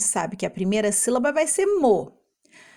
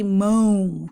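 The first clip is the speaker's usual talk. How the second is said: MÃO held long as a word ending; drawn-out syllable; 4 dB louder than usual. Shown as a demonstration. Teacher asking which fruit ends so, MÃO held long. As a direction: stretch out MÃO slowly. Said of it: "termina desse jeito".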